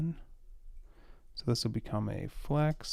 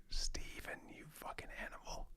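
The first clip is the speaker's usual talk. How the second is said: whisper; 15 dB quieter than usual. Real, not acted. Muttering about coworker's manual kerning.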